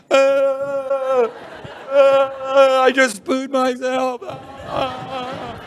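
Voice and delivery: put-on high, held child's wail; wailing little Hunter. Little Hunter running to mum, comic wail. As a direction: bawl it out high, dragging the words. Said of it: "And then Hunter comes running".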